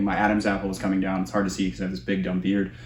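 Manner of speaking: ordinary talk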